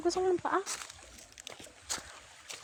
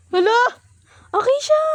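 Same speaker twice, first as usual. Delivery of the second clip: screaming while falling; 8 semitones higher, 8 dB louder than usual. Real, not acted. Slipping on wet rocks by river.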